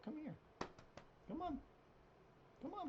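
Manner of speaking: very soft, high-pitched pet call; coaxing the cat. Real, not acted. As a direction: coax gently in a tiny high voice. Coaxing the cat to come over.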